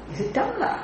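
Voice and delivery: mock disbelief, soft and high; incredulous high rise. Shown as a demonstration. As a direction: ask as if the idea is absurd. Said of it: "You won't say".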